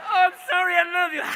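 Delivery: high, loud mock wail; maudlin drunk apology. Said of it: "we're moody little fuckers".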